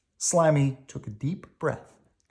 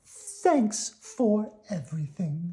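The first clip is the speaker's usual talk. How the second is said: high, slow sweet worm voice; country cousin worm, polite; 8 semitones higher, slower than usual. Acted. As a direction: ask very politely, pitched high.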